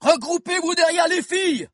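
very high yelled command; rally the children with a cry; Bill ordering the tipi children to regroup, yelling; shouted order. Acted. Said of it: "cria Bill".

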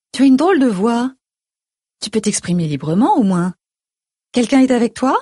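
lightly voiced mother probing; suspicious mother. A narrator's reading. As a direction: question your daughter with a touch of alarm.

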